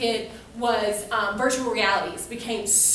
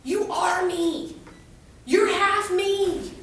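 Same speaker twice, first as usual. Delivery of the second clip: very slow, higher, every word stretched out; drawn-out insistence; 6 semitones higher, much slower than usual. Retold or imitated. Mom telling daughter they look alike, slow insistence. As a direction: imitate an insistent mother, stretching "You are me". Mother's emphatic retort, retold.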